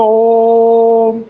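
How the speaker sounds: ओम held long as a three-beat sound; drawn-out om. Shown as a demonstration. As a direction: stretch ओम out like a chant. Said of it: "इसको कैसे बोलते हो?".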